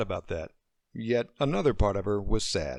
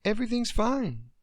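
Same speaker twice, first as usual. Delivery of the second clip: forced reassurance; 12 semitones higher than usual. Acted. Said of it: "Deidre tried to sound like that was true".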